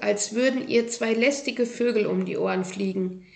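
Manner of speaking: ordinary delivery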